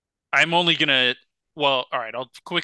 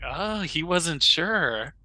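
drawn out, slow dawning realization; 3 semitones higher, much slower than usual. Retold or imitated. Telling a friend about the cashier, slow mock realization. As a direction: let it dawn slowly. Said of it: "I was like".

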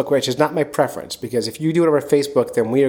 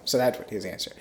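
ordinary delivery

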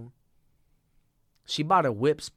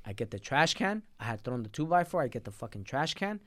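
normal talking voice

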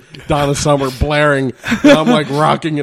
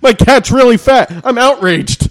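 higher, louder mock outrage; hammed-up outrage; 8 semitones higher, 5 dB louder than usual. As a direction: fake a petty complaint. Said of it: "mock complainers".

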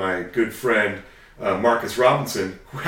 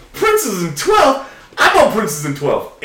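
very high, loud, stretched-out disbelief; astonished retold echo; 12 semitones higher, 9 dB louder, slower than usual. Friend discovering they share a street corner, astonished. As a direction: shout it back as an amazed friend would.

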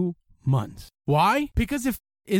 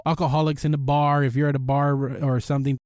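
ordinary talk, nothing marked

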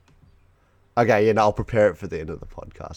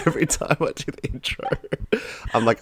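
ordinary talk, nothing marked

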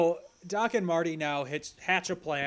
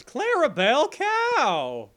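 high comic punchline; 12 semitones higher, 9 dB louder, slower than usual. Acted. Silly name punchline.

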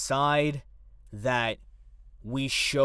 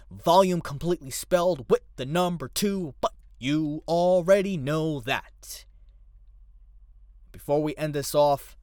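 normal talking voice

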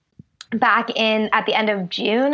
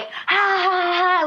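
big, high-pitched "ha ha" belly laugh; robust demo laugh; 9 semitones higher, slower than usual. Shown as a demonstration. Guest showing how they laugh in an audience, robust high laugh. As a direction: laugh wide-mouthed, high and unrestrained. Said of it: "the way I laugh".

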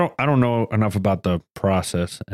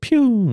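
pew sound effect; 13 semitones higher, 3 dB louder than usual. Pretend. Mimics shooting a button.

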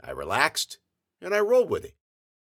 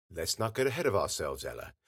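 lower, softer Daddy voice; calming father; 6 semitones lower, 7 dB quieter than usual. A narrator's reading. Daddy reining in his hungry daughter, calm and low. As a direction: rein her in softly, dropping at the name.

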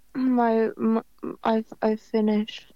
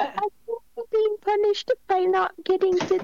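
high, whiny mock-crying child voice; Eric crying to mom; 11 semitones higher than usual. South Park boy complains to his mom, in a whiny mock cry. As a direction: wail it like a spoiled kid pleading. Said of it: "whenever Eric was crying to his mom".

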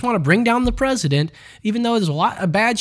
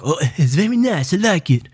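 very bad Trump impression. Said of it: "That's a very bad Trump".

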